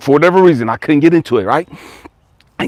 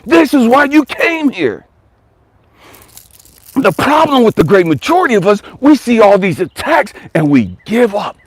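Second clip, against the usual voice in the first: fired-up preaching; 7 semitones higher than usual. Acted. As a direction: preach it like you're lifting the room up. Motivational preaching to viewers.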